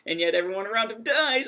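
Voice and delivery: high-pitched, hammed-up complaint; mock-dramatic lament. Podcaster mocking a hero's tragic luck to co-hosts, mock-dramatic. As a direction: wail it in mock despair.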